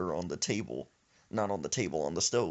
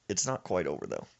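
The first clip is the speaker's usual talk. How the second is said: normal talking voice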